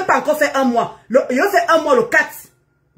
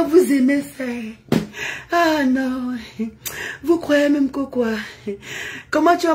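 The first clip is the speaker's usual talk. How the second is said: fausse douceur; 3 semitones lower than usual. Pretend. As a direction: put on an exaggerated gentle voice, lower and lingering. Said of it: "C'est ça que vous voulez que je parle". (douceur feinte, voix traînante)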